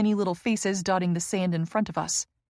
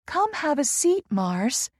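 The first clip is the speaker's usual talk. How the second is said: much higher, sweet cooing invite; 7 semitones higher, slower than usual. A narrator's reading. Inviting the man to sit beside her, cooing. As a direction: coo it to lure him closer. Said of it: "she coos".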